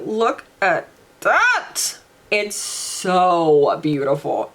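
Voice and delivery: drawn-out, hushed admiration; slow, tender awe. Real, not acted. Telling viewers, moved, how beautiful it is. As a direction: linger on each word, moved. Near tears over shadow.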